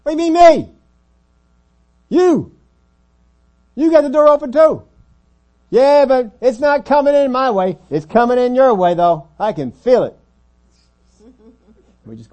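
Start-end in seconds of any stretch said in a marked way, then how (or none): 0.0-4.8 high, full-volume indignant yell; acted shouting match retort; hollering back. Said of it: "him hollering back". 5.7-10.2 high, loud argumentative shout; acted hollered complaint; yelling back. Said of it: "yelling at the person".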